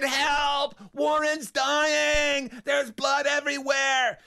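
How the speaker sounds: high, drawn-out cry for help; hammed-up screaming alarm, words stretched; panicked shouting. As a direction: yell the emergency through a closed door. Child yelling for help at the door.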